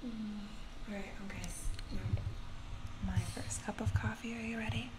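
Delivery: soft unvoiced whisper. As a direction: breathe the words close to the mic. ASMR whisper.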